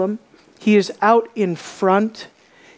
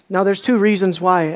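ordinary talk

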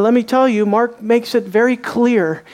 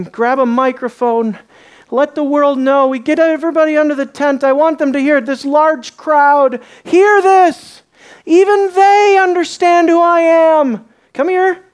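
higher, louder eager proclamation; Jesus rallying the crowd; 7 semitones higher, 4 dB louder than usual. Acted. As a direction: call the crowd in like a showman. Jesus voiced urging the crowd.

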